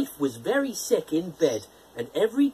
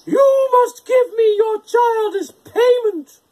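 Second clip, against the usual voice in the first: high, loud, slow screeching witch; shrill-voiced hag demanding the baby; 9 dB louder, slower than usual. A narrator's reading. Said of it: "and she screeched".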